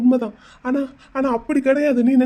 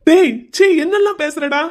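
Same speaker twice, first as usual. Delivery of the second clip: high, loud disgusted snap; shocked outburst; 5 semitones higher, 3 dB louder than usual. Acted. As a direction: recoil in shock at her words. Husband shocked by her words. (அதிர்ச்சியுடன் கோபக் கத்தல்)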